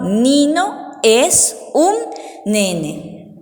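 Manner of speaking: slow, spaced words; dictation. Shown as a demonstration. Teacher covers the card and dictates, slow and spaced. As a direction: dictate it slowly, pausing before the last word. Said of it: "ustedes sepan a dictado".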